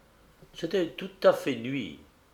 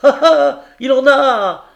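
very high, very loud cry; triumphant shout; 13 semitones higher, 14 dB louder than usual. Acted. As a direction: crow over the hit. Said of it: "Il cria".

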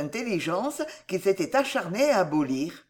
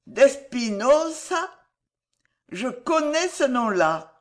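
slow, raised, dawning recognition; searching memory; 6 semitones higher, slower than usual. A narrator's reading. Madman begins to recognise the name, slow and wondering. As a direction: stretch it out as memory stirs. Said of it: "qui cherchait à se souvenir".

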